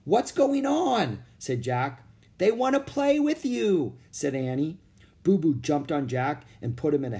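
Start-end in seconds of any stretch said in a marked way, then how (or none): 0.0-1.2 high, slow, startled question; bewildered. Acted. Jack knocked over by playful gorillas, high startled question. 2.4-3.9 excited. Acted. Annie explains gorillas' play.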